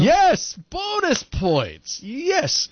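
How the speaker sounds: high excited host cheer; game show host. Acted. Quiz host cheering answer.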